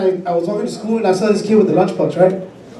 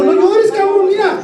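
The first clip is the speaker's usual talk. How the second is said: lightly voiced mother, pitched high; imitated mom; 11 semitones higher than usual. Mom to her crying son, imitated scolding reassurance. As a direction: hush the kid in a raised pitch. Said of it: "me dice mi mamá".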